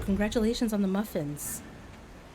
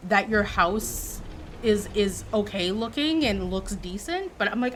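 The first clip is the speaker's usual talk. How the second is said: ordinary talk, nothing marked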